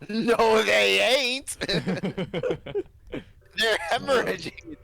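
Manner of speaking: squeaky high joking voice; playful high-pitched mock protest; mock squeal.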